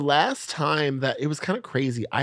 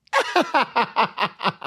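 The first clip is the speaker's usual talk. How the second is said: real high-pitched burst of laughter; 11 semitones higher, 3 dB louder than usual. Real, not acted. Cracking up at the punchline.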